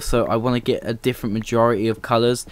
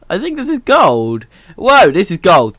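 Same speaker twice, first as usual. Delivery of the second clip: excited surprise; 7 semitones higher, 6 dB louder than usual. Real, not acted. Finds gold in the mine.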